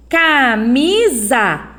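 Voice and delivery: camisa said slowly, the S buzzing like Z; drawn-out camisa. Shown as a demonstration. Teacher slowly sounding out camisa for pupils. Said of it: "Ó o som, ó".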